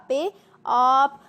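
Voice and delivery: آپ with the long آ drawn out slowly; stretched madd vowel. Shown as a demonstration. Teacher drawing out the madd vowel for pupils. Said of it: "مد کو ہمیشہ ذرا کھینچ کر لمبا کر کے پڑھتے ہیں".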